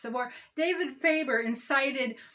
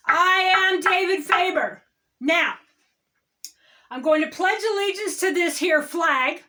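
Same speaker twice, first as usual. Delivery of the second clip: David Faber; 4 semitones higher, 5 dB louder than usual. Acted. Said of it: "I am transitioning into David Faber".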